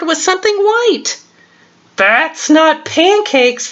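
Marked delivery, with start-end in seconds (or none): 2.0-3.7 high, slow little bird voice; twittering sparrow. Acted. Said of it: "the sparrow twittered".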